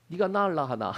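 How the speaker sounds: Gyeongsang dialect Jesus, calm and dignified. Retold or imitated. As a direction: say it in a gentle Daegu Gyeongsang accent. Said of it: "사투리로 읽어버렸어요". (경상도 사투리로 점잖게)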